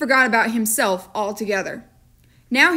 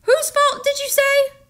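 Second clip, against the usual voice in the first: much higher, far-off incredulous question; incredulous storekeeper; 3 dB louder than usual. A narrator's reading. Storekeeper questioning John's blame, much higher and incredulous. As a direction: feign disbelief in a put-on voice. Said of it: "storekeeper questioning".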